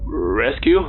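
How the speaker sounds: a faint, hesitant little question; timid mumble. Acted. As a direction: ask it timidly, barely daring. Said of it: "Harrington mumbled timidly".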